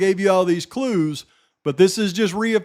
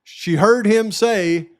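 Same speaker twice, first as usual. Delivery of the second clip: usual speaking voice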